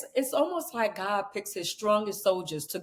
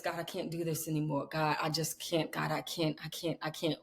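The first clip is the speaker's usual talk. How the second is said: lower, softer, a defeated plea; despairing prayer; 6 semitones lower, 9 dB quieter than usual. Retold or imitated. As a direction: murmur it like a tired prayer. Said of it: "when you was telling God these things".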